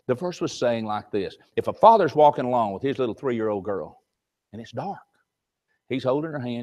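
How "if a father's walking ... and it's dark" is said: normal talking voice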